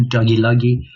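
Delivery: amused repeat of doggy loggy; laughing. Real, not acted.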